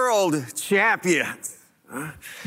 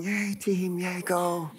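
limp mock cheer; 7 dB quieter, slower than usual. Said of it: "would you be using your voice going".

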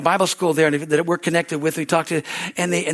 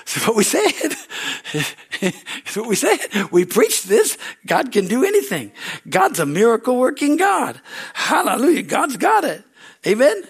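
preaching; 7 semitones higher, 4 dB louder than usual. Retold or imitated. Preaching believers' words retold.

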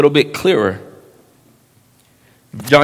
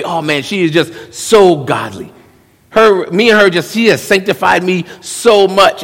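mock smitten young man; 6 semitones higher, 4 dB louder than usual. Mocking a young man's boast.

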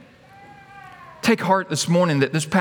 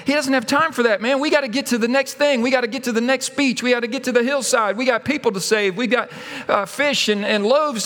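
higher, hurried brush-off; acted impatient hushing; 6 semitones higher than usual. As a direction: brush off a nuisance, busy and insistent. Voices followers silencing the beggar.

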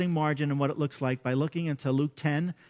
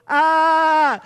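loud, high sustained scream; mock scream of alarm; worried scream; 8 semitones higher, 9 dB louder than usual. Shown as a demonstration. Preacher reacting to a worrying scripture, loud mock scream. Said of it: "I wanna go".

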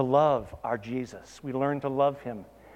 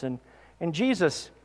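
usual speaking voice

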